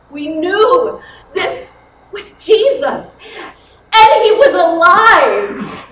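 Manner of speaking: loud, high, slow cry of joy; a slow, ringing shout of discovery; joyful exclamation. Acted. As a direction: proclaim it with rising wonder. Recognition of the risen Jesus.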